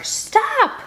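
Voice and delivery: high loud yell. Acted. Annie yelling over the arguing scouts, loud yell. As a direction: cut them all off. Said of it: "I yell even louder".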